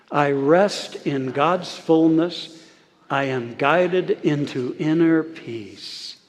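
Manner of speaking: very slow, steady affirmation; leading group recitation. Shown as a demonstration. Preacher inviting everyone to say it together, slow and deliberate. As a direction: stretch each phrase for voices to join. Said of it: "Let's say that together".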